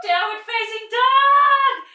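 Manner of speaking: very high, loud, stretched-out call; a big joyful slow shout; triumphant announcement. Real, not acted. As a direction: announce the pose like a victory. Cheering release into pose.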